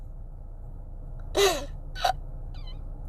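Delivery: high breathless crying gasp; tearful gasp. Real, not acted. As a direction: gasp for air between sobs. Crying while running.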